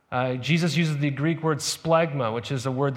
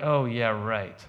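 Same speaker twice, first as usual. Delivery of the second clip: low, dry sarcastic oh yeah right; mock disbelief, lower and dry; sarcastic eye roll; 7 semitones lower, slower than usual. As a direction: scoff at the story. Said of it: "they're like".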